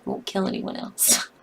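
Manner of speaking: breathy aside under the breath; whisper. Real, not acted. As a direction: whisper it to yourself, relieved. Relieved aside after deaths.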